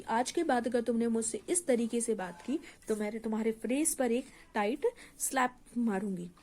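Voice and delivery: normal talking voice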